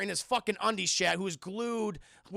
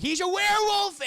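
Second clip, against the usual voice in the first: incredulous exclamation; 12 semitones higher, 7 dB louder than usual. Retold or imitated. Friends explain Steve Buscemi's delusion.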